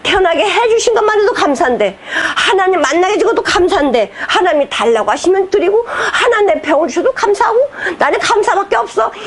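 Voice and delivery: high, happy, laughing thanksgiving; joyful gratitude. Retold or imitated. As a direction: act out a believer overflowing with thanks. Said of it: "이렇게 하면은".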